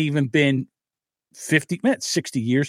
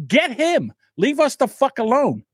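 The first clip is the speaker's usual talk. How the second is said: calling out; 10 semitones higher than usual. Retold or imitated. Civilians imagined pointing out fighters.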